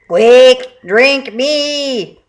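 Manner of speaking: pushy juice box robot. A narrator's reading. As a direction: shove the line like a pleading toy. Juice box robot begging Forky.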